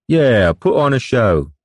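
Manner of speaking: put-on slow, high jeering drunk; drunken sailor. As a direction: egg him on like a drunk sailor.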